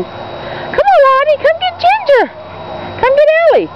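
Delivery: very high sing-song calls; urging the dog. Real, not acted. Owner coaxing her dog to go get the others. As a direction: coax her up high and eager.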